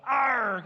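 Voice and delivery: high, very loud "arrr"; bully pirate roar. Shown as a demonstration. Teacher rallying kids to roar for Saul, big pirate growl. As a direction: belt out a mad pirate growl. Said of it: "big bully pirate, okay? So go".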